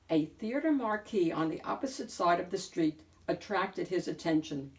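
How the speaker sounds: ordinary delivery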